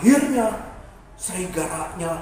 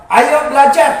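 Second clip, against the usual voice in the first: loud, commanding parent; ordering parent; 3 semitones higher, 11 dB louder than usual. Acted. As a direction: call a child to homework. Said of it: "kalau disuruh sama papa".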